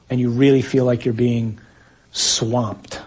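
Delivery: ordinary talk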